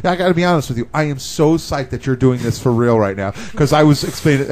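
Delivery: ordinary talk, nothing marked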